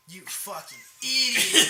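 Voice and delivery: loud, slow mock yell of an angry manager. Manager across the kitchen yelling at his worker, loud mock yell. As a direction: bark it like a boss across the kitchen. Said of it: "my manager from across the kitchen was like".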